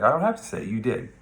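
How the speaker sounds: high, loud cutting retort; April's retort. Retold or imitated. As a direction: fire it back at him.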